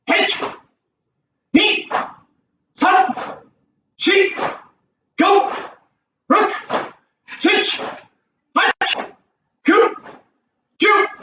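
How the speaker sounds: high, sharp counting calls. Shown as a demonstration. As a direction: call the count like a drill. Counting reps for class.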